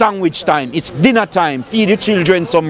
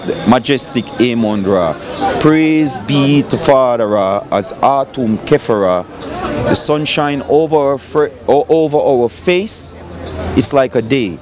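solemn prayer; 5 semitones lower than usual. Acted. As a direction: call on the gods like a preacher blessing the day. Opens a prayer to Ra.

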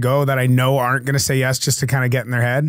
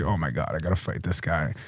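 softer, lower inner voice of dread; inner panic; 8 semitones lower, 9 dB quieter than usual. Retold or imitated. Player dreading the fight, hushed inner panic. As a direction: think it to yourself in a hushed panic. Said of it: "but really in my head".

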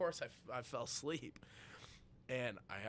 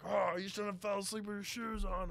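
high, mocking singsong; smug taunt; 9 semitones higher, 4 dB louder than usual. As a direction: rub it in like a smug friend. Friend mocking him.

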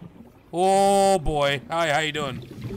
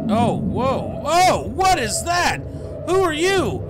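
high frightened shouts; panicked yelling; 9 semitones higher, 7 dB louder, slower than usual. Real, not acted. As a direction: yell in real panic. Panicked at creature attack.

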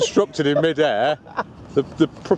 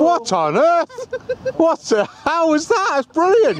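high excited exclamations; astonished, thrilled outburst; 11 semitones higher, slower than usual. Real, not acted. Plane does surprise upside-down trick.

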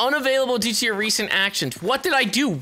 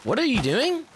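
ordinary delivery

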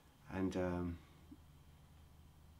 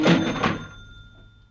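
loud laugh; 11 dB louder than usual. Real, not acted. Laughs after ka-ching.

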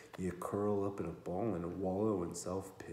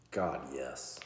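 plain reading voice

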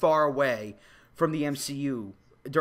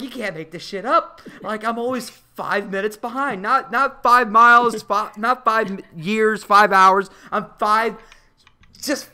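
louder, higher exasperated rant; frustrated rant about always being late; mock exasperated rant; 4 semitones higher, 7 dB louder than usual. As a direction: vent about always missing it. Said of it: "she's like".

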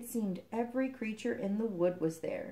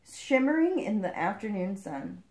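ordinary delivery, nothing marked

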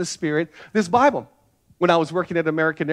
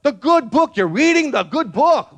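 high, louder insisting pilot; insistent pilot; 7 semitones higher, 4 dB louder than usual. Retold or imitated. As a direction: press the point on a clueless worker. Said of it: "He's like".

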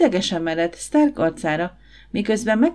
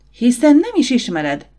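louder, higher burst of indignant anger; angry exclamation; 6 semitones higher, 6 dB louder than usual. A narrator's reading. Desdemona defending her brother to Stark, angry exclamation. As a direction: cry out in anger, pitch jumping up. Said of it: "kiáltott fel Desdemona dühösen".

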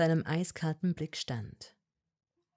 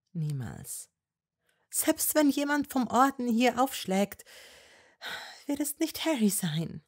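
put-on higher young woman's voice, pleading and firm; Hermine voice; 8 semitones higher, 3 dB louder than usual. A narrator's reading. Hermine to Malfoy, higher and insistent. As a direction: argue with him, voice raised high.

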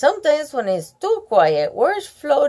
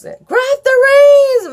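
high, loud slow call; yelled; 13 semitones higher, 6 dB louder, slower than usual. Acted. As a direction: call out to the runaway rider. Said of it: "Megan yelled".

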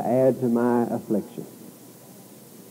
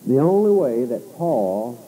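usual speaking voice

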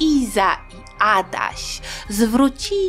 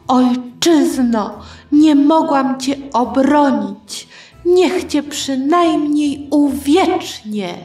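higher, slow, stately proclamation; solemn declaration; 5 semitones higher, 3 dB louder, slower than usual. A narrator's reading. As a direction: declaim it like a patriotic vow. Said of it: "powiedziała takie piękne zdanie". (uroczysta, podniosła deklamacja)

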